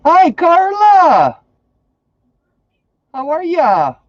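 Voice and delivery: high, slow, stretched sing-song hello; high greeting. Real, not acted. Greets viewer by name, sing-song.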